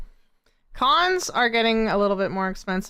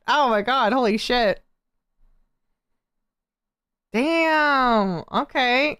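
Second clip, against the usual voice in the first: ordinary talk, nothing marked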